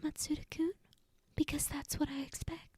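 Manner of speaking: soft, gentle whisper. A narrator's reading. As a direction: breathe it close to his ear. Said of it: "She whispered gently to him".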